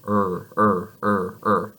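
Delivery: "er" with a tense tongue pulled back; English R sound. Shown as a demonstration. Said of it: "The middle of our tongue".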